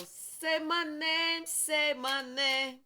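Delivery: lightly voiced god figure, slow and high. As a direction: call out slowly as God demanding. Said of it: "God letting somebody know".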